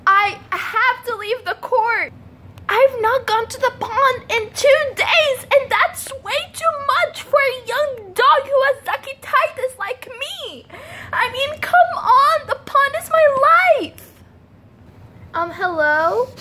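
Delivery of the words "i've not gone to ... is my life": high, whiny puppy voice with a cry at the end; a pleading little dog who misses his pond; young dog voice. Acted. Charlie the dog whining to the judge, high and whiny.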